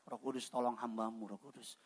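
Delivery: low, very soft plea under the breath; muttered prayer, re-enacted. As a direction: mock-desperate aside to God. Acts out silent desperate prayer for help.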